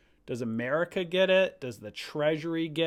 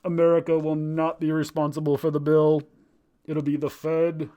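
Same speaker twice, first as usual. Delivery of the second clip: a light Trump impression, louder; 8 dB louder than usual. Streamer answering his own question as a light Trump voice. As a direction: answer as a hint of the president, firm. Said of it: "I was interviewing President Trump".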